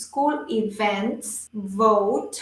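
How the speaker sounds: "school events, vote" slowly, with strong V in "events" and "vote"; low, slow "school events, vote" stressing each V; strong V sound. Shown as a demonstration. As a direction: draw out "school events, vote", firm V, never B. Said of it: "incorporar ese sonido".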